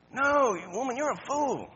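high-pitched, exclaimed correction; exasperated telling-off, pitched high; emphatic rebuke. Acted. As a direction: cut in with a high, insistent objection. Rebukes the imagined wife.

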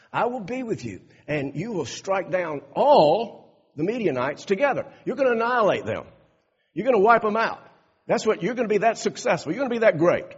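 high, loud impassioned preacher voice; full-force preaching. Acted. Preacher voicing God's call to Gideon, impassioned. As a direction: urge them on, pulpit fire.

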